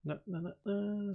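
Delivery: ordinary delivery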